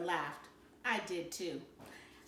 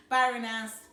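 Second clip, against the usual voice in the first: ordinary delivery, nothing marked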